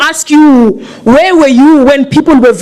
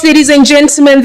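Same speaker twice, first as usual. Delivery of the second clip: normal talking voice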